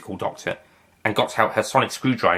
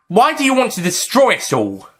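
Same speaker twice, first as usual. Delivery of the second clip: high Doctor voice; 12 semitones higher, 4 dB louder than usual. Acted. Doctor questioning Master.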